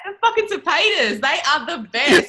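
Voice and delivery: high, laughing rave about friends; gushing praise. Real, not acted. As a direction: gush about them with joy. Gushing about admired leaders.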